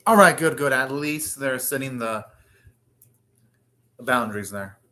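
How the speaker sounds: ordinary talk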